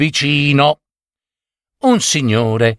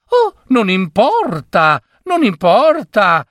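high, lilting carefree voice; blithe joy; 7 semitones higher than usual. A narrator's reading. Lady waves away the lawyer's objection, blithe and joyful. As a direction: brush it off merrily. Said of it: "tutta giuliva".

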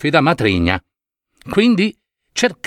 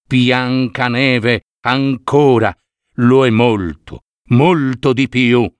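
lower, slow, solemn mirror voice; magic mirror voice; 4 semitones lower, slower than usual. Acted. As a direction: speak as an oracle, lower and slow. (lo specchio magico, voce più grave e lenta)

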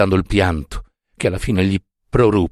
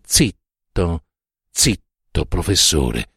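stern hushing order; 5 semitones lower, much slower than usual. A narrator's reading. Lamella shaking the drunk professor, stern hushing. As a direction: order him to hush.

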